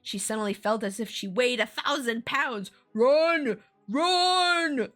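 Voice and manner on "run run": high, loud frantic yell; high loud frantic run; frantic cry. Acted. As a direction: yell it in panic. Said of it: "the store owner repeated his frantic cry".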